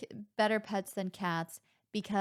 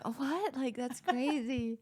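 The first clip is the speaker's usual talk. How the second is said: higher, imitating baffled innocence; acted puzzled surprise; 4 semitones higher, 4 dB quieter, slower than usual. Sisters answering the worried neighbour, mock surprise. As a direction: play clueless and mildly shocked. Acting out their baffled response.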